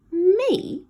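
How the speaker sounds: can't believe what he hears; astonished. Acted. Ned stunned that his sister worried about him, astonished.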